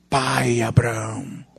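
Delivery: low, slow, softened plea; subdued pleading cry. Retold or imitated. Rich man calling to Abraham from afar, subdued plea. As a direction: call out low and drawn-out, imitating a plea. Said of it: "ele clama assim".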